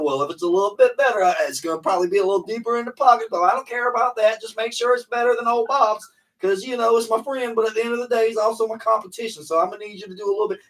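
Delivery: high, loud, fast rich customer impression; rich client voice. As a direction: talk like a competitive rich neighbor. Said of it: "hit 'em with the".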